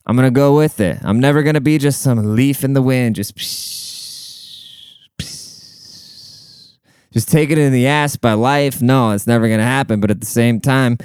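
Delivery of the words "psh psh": long, unvoiced hissing "psh"; imitated whoosh. Shown as a demonstration. Speaker mimicking a leaf in the wind, soft whooshing. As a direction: blow a soft, airy whoosh.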